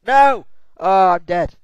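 loud cry at losing, high at first; dismayed shout. Real, not acted. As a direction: shout in dismay as you die. Character dies in the game.

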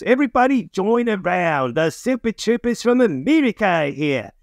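high, sing-song Aussie announcer; hammed-up Australian emcee voice. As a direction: hail the crowd like an Australian mall host. Mall host announces stars in Australia.

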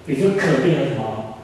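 usual speaking voice

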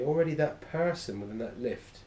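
ordinary talk, nothing marked

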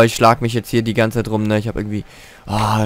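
normal talking voice

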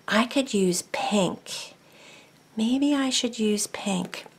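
soft unvoiced whisper. Real, not acted. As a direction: whisper it to yourself. Whispering while crafting.

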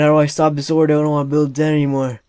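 usual speaking voice

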